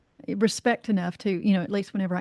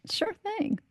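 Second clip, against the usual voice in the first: sure thing pitched high and soft; honey-coated, sarcastic agreement; mock-cheerful reply to the insulting boss; fake-sweet reply; 11 semitones higher, 7 dB quieter than usual.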